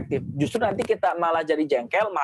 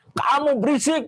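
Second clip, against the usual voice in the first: mock scolding; 9 semitones higher than usual. Parent scolding noisy child.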